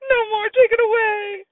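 high, tearful wail of fear; a scared toddler begging through tears; child crying in terror at the clown; frightened crying scream. Acted. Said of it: "Sam started to scream".

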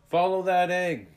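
loud, high yell to chase. A narrator's reading. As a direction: yell it after the rolling egg. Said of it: "Tag yelled".

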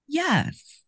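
high, falling squeak of assent; high-pitched little yes; squeaked answer. Acted. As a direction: squeak out the answer to a creepy caller. Nervous answer to creepy caller.

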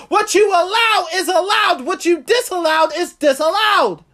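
high, loud, driving sermon cadence; preaching at full force. Acted. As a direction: whip the crowd up to revival. Preaching climax.

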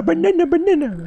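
high, slow put-on voice saying banana; imitating the mute girl. Showing podcast friends how she talks, high slow imitation. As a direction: drag out each banana in a high silly voice. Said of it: "é o jeito que ela fala".

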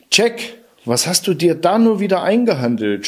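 mother calling out to her son in alarm; panicked shout. A narrator's reading. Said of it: "schrie sie ihrem Sohn zu".

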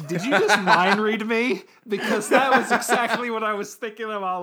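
high, loud, laughing disbelief; laughing amazement. Real, not acted. Host to co-host who stole the joke, laughing in amazement. Host amused co-host read his mind.

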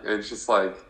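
ordinary talk

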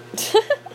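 short high-pitched chuckle; giggle. Real, not acted. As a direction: let out a brief, high laugh. Laughs after own advice.

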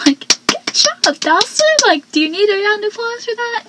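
high-pitched, laughing sarcasm; mock congratulations with an incredulous lift; sarcastic praise. Real, not acted. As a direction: tease him with exaggerated, high-pitched praise. Mocking Dawson's idea with sarcastic praise.

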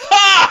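high, explosive "ah!" laugh; loud laugh burst. Retold or imitated. Dad reenacts his laugh at the sales video, high explosive burst. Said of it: "like my laugh, like".

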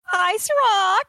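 high, drawn-out cheery hello; excited greeting. Acted. Player greeting the ogre on arrival, high and drawn out.

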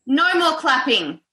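mum voice. Real, not acted. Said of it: "You can hear my mum voice right now".

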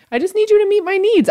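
squeaky pleading demand; mock-whiny high plea; high exasperated plea.

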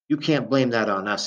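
ordinary delivery, nothing marked

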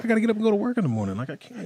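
high fast pleading; whiny excuse. Acted. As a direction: beg off in a rush. Pleading excuse not to fight.

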